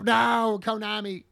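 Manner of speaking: high, loud hammed-up begging; mock pleading. Kids plead with Konami in mock despair. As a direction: beg the game company for mercy. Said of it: "And they go".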